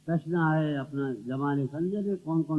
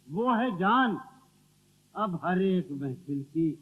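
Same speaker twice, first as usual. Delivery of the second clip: ghazal recitation; 5 dB louder, slower than usual. Acted. Poet reciting to the gathering, declamatory verse recital. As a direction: declaim verse with weighted pauses.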